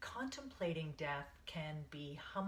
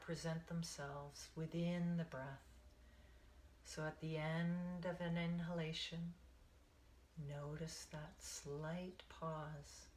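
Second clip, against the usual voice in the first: guided meditation. Acted. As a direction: ease them into the breath, one count at a time.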